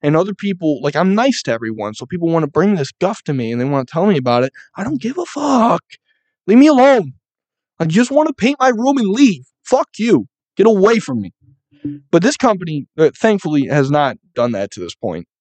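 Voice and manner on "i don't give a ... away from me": high, loud exasperated yell; fed-up outburst. Pretend. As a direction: shove the gossipers away. Imagined outburst at gossiping coworkers.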